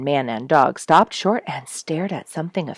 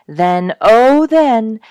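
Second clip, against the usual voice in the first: very slow, much louder climax; dramatic build; 6 semitones higher, 10 dB louder, much slower than usual. A narrator's reading. Storyteller reaches the climax, slow and loud. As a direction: drag it out for suspense.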